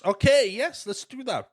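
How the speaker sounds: high, loud-starting mock enthusiasm; mock eager agreement. Boy seizing the old man's advice, mock eagerness. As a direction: jump on the idea, hammed up.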